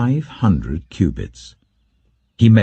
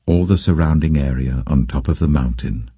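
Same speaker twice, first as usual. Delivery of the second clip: lower, solemn divine voice speaking from the temple; the Lord addressing the prophet, lower and solemn; voice of God; 7 semitones lower than usual. Acted. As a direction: read as the Lord, lower and measured.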